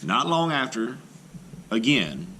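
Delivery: ordinary delivery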